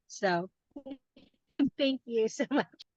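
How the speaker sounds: high, laughing thank-you; laughing while thanking the group. Real, not acted. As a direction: let a real laugh break through the thanks.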